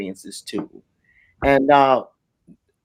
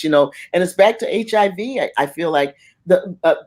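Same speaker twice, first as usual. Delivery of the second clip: usual speaking voice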